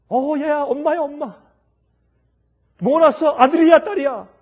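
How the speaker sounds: loud, high eager questions on the phone; excited grandma. Acted. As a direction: burst with excitement, asking boy or girl. Excited grandmother calling about birth.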